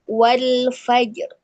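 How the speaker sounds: wal fajr with a thick, heavy ra; correct heavy ra. Shown as a demonstration. Teacher demonstrating the heavy ra in wal fajr. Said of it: "Ya, saya contohkan".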